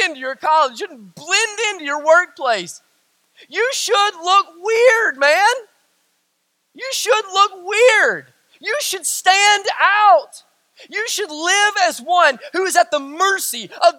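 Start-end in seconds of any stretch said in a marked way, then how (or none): 3.5-10.4 high, slow and insistent; drawn-out emphatic plea; emphatic preaching. Real, not acted. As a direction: stretch out each word to drive it home. Preacher urging congregation to stand out.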